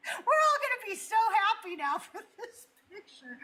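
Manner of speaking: high, laughing mock cheer; sarcastic. As a kid mocking her angry dad's photo demands, laughing sarcastically. Sarcastic childhood thought about dad.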